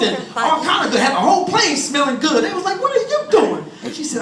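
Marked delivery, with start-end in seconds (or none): 2.8-3.6 high-pitched, incredulous question; baffled disbelief. Acted. Passenger to woman cooking on the plane, incredulous. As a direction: ask it in acted astonishment.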